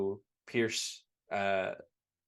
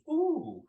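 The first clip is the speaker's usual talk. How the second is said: high-pitched little "ooh"; mock startled ooh. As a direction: imitate a woman's surprised, high ooh. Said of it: "she kinda goes".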